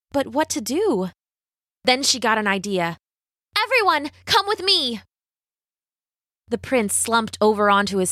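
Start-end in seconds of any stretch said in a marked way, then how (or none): 3.6-5.0 high heroine calling out; high-pitched call to the crowd; rallying Rapunzel. A narrator's reading. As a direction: summon them in a high, urgent voice. Rapunzel rallies the villagers.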